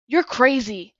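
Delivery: loud shocked accusation; exclaiming. A narrator's reading. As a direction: blurt it out in shock. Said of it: "Cole exclaimed".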